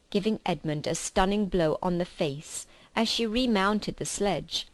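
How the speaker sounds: ordinary delivery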